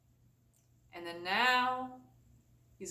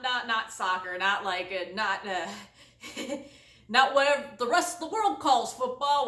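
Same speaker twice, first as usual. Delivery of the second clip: usual speaking voice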